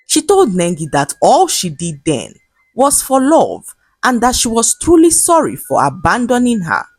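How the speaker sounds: plain reading voice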